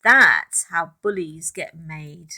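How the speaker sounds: ordinary delivery, nothing marked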